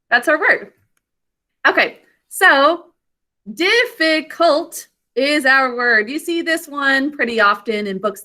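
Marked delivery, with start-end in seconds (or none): 3.5-4.9 "difficult" slowly parted as dif-fi-cult; syllable by syllable. Shown as a demonstration. Teacher introducing the word, "difficult" in three slow parts. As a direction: sound out each syllable of "difficult". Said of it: "Fingers under your chin to say it".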